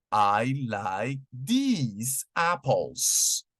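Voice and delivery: "I like these apples" spoken very slowly; very slow model sentence. Shown as a demonstration. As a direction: linger on "these" to teach it. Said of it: "Un ejemplo sería".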